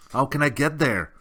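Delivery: high, loud eager question. A narrator's reading. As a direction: ask eagerly. Atreyu asking urgently.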